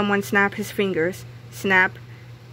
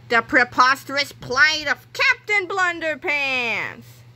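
slow, higher announcer voice; movie trailer title read; dramatic title; 5 semitones higher, slower than usual. A narrator's reading. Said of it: "chapter title read dramatically".

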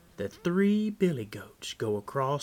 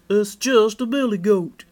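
higher, louder billy goat voice; goat's reply; 7 semitones higher, 12 dB louder than usual. A narrator's reading. Big Billy answers the troll, high loud goat voice. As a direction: pitch it up and project, a goat answering.

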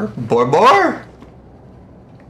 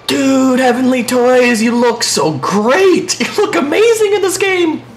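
gushing delight; 8 semitones higher than usual. Real, not acted. Gamer praising a remade level, gushing.

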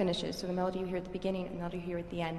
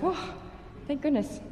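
high, breathed-out mock relief; relieved sigh into higher pitch; retold relief; 7 semitones higher than usual. Violinist recalls finally arriving after flight delays, mock relief.

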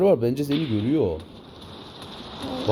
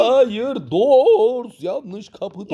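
excited protest; 9 semitones higher, 4 dB louder, much slower than usual. Real, not acted. Arguing over wrong door.

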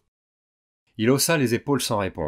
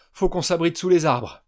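urgent order; 6 semitones higher, 6 dB louder than usual. Acted. Urgent order in burning rain.

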